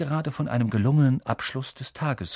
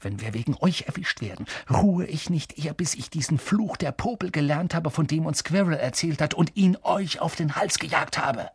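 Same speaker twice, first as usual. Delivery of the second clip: tense, higher-pitched threat; furious threat; 5 semitones higher than usual. A narrator's reading. Ron warns Hermine and Neville with a curse, angry. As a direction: threaten them with held-back anger. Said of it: "blickte dann Hermine und Neville wütend an".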